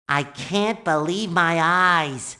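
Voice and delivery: low, slow amazement; awed disbelief. Acted. Sponge marvelling at the museum, low awed disbelief. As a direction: take it in slowly, astonished.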